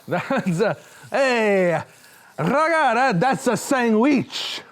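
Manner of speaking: high, slow goofy voice; silly sandwich reveal. Pretend. Host showing the sandwich to camera, goofy high voice.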